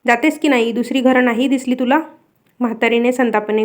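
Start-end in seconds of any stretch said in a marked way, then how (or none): none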